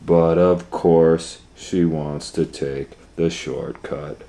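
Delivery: ordinary delivery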